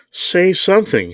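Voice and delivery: high, forceful command, read aloud; ordering. A narrator's reading. Mister Thompson demands an answer, ordering. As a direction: demand it with the pitch jumped up. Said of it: "he ordered".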